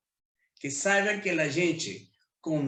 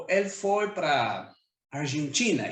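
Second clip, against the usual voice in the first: ordinary talk, nothing marked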